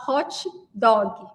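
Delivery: "hot dog" with an added i ending each word; Brazilian pronunciation. Shown as a demonstration. Teacher showing how Brazilians learn it, Brazilian pronunciation. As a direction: say "hot dog" as "hótchi dógui". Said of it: "no Brasil a gente fala".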